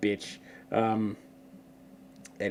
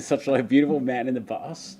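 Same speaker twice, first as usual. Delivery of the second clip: usual speaking voice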